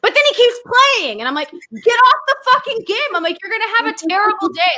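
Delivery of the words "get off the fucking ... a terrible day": high, heated nagging; exasperated scolding. Retold or imitated. Telling partner to quit playing before bed, exasperated. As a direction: scold him off the game, higher-pitched.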